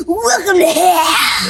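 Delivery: gravelly death growl shout; growly metal voice. Retold or imitated. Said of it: "godfather of the gravel and growl voice".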